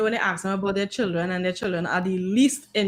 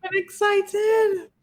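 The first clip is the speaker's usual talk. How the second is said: mock excited; 11 semitones higher, much slower than usual. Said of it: "not excited".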